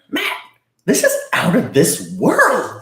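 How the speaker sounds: slow, high, showy TV-chef voice; Guy Fieri impression. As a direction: hype it up like a food show host. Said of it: "He looks at me and goes".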